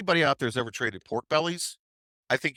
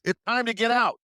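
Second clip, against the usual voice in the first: high, urgent call to sell; yelled warning; 13 semitones higher, 3 dB louder than usual. Retold or imitated. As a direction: sound the alarm, high-pitched.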